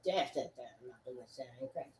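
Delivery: very quiet, low mutter. Real, not acted. Speaker backing off after a yell, muttering low. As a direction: drop it right down under your breath.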